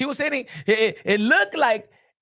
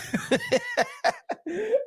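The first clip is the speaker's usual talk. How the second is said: high burst of laughter; 5 dB quieter than usual. Real, not acted. Laughing after mock song.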